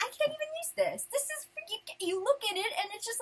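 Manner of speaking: high, indignant complaint; worked-up, high-voiced griping; mad. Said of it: "I was so mad 'cause I'm like".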